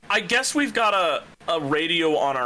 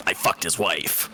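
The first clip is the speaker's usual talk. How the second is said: low, gruff soldier voice; Solid Snake impression; 12 semitones lower, slower than usual. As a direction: do Solid Snake, deep and serious. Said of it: "decent Solid Snake impression".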